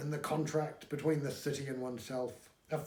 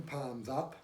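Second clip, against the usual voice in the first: plain reading voice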